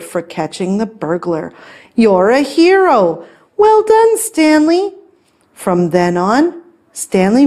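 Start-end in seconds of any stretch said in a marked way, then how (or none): 1.9-4.9 high-pitched congratulating voice; delighted praise. A narrator's reading. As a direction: praise the hero excitedly. Lady praises the cat.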